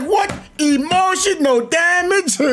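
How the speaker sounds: high, slow and drawn out, mocking the guy; mock high quote. Retelling a funny game clip, high drawn-out mock quote. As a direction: stretch it up high like a disbelieving joke. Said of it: "He's like".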